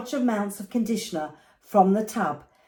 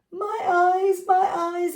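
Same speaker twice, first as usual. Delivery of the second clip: much higher, mock pained cry; girl with soap in her eyes, high pained cry; child screaming; 9 semitones higher than usual. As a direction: shriek about the stinging shampoo. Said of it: "Ada screamed".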